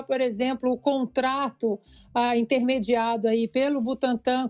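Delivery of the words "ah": ordinary delivery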